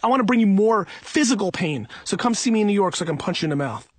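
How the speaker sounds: ordinary talk, nothing marked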